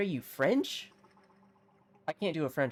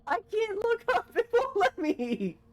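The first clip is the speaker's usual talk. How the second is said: squealed mock distress; 5 dB louder than usual. Gamer unable to look up, high comic wailing. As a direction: wail helplessly with a laugh breaking in. Game won't let them look up.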